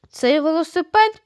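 ordinary delivery

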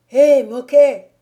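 loud, high, slow hail; shouted call. A narrator's reading. Gamekeeper hailing Moquet across the wood, shouting. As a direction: holler to someone far off. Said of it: "cria le garde champêtre".

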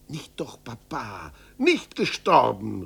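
breathless, whispered plea; urgent whisper. Acted. Son whispering to his dying father not to die. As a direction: hush him, pleading. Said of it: "rief Zäpfel aus".

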